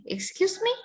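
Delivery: "excuse me" pitched high, climbing sharply on "me"; questioning rise. Shown as a demonstration. Teacher contrasts the meaning of "excuse me", rising like a question. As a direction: ask "excuse me" with a steep upward lift. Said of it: "Artinya berbeda dengan".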